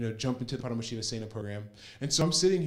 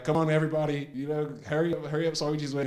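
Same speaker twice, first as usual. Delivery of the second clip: raised, louder call to hurry; urgent hurrying; 4 semitones higher, 4 dB louder, faster than usual. Retold or imitated. Helpers rushing people as Swamiji waits, urgent hurrying. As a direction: imitate someone chivvying a group along. Imitates others hurrying the group along.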